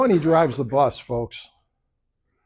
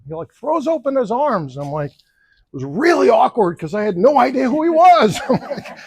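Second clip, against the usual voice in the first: ordinary delivery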